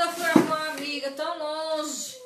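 normal talking voice

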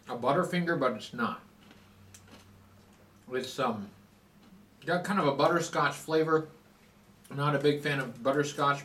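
chewing while talking; sticky candy in mouth; mouth full of candy. Real, not acted. Seen 3: cheek bulges while chewing, holding bitten honeycomb candy piece.

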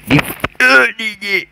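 high, mock squeaky repeat of the name; silly voice. Gamer mocking the level creator's name in a goofy high voice.

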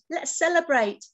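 rally them to a party, lightly; cheering. A narrator's reading. Friends celebrating found pants, light cheer. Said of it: "we cheered".

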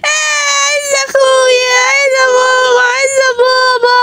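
loud, high-pitched bawling; drawn-out, tearful howl; crying. Acted. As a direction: wail for your family like a small child. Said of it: "واحدة بره قاعدة بتعيط".

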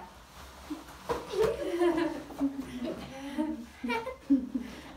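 loud high giggling; giggling at herself; giggle fit. Real, not acted. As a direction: burst out in giggles. Laughing at their intro.

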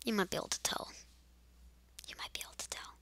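secretive whispered aside. Acted. Confiding to viewers in a hushed whisper. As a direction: breathe it out, barely voiced.

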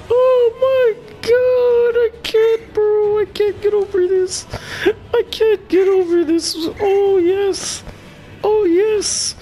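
very high, thin falsetto whine; high-pitched happy whimpering; overwhelmed squeal. Real, not acted. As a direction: squeak it out as if overcome with joy. Overwhelmed with joy.

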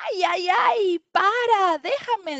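high, loud strangled plea; choked voice. Acted. Little leopard protesting a crushing bear hug, choked and high. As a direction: cry out as if squeezed too hard. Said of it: "dice Leopardito con voz ahogada".

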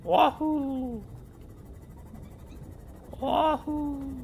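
high Mario wahoo; jump-yell like Mario. Pretend. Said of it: "my Chris Pratt impression of doing Mario".

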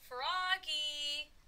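loud, high, drawn-out call. A narrator's reading. Teacher calls on the hiding Froggy, calling out. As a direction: sing the name out at full volume. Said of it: "called his teacher".

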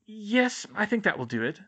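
musing, starting high then settling; to herself. A narrator's reading. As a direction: think aloud, deciding on a treatment. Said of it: "she said to herself".

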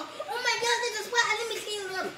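very high, mock-panicked girl voice. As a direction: fret in a high put-on voice.

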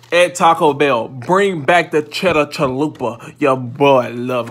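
slow, loud call to Taco Bell; loud plea. Real, not acted. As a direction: shout the demand like a fan. Pleading for cheddar chalupa.

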